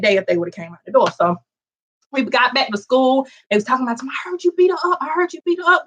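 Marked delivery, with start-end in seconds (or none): 4.0-5.8 gossiping classmates. Retold or imitated. Schoolkids gossiping.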